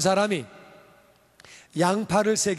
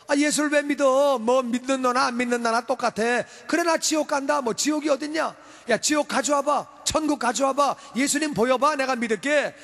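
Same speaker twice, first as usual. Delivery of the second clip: mocking unbeliever; 6 semitones higher than usual. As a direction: taunt like someone daring God. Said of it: "이렇게 말하는 사람".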